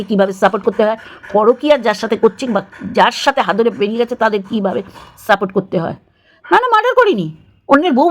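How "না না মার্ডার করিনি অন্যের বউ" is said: high, sing-song mock protest; a sarcastic imitation of defenders; mocking excuse. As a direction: parrot their excuse in a shrill mocking tone.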